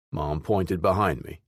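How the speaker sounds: ordinary delivery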